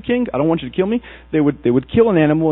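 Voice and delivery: normal talking voice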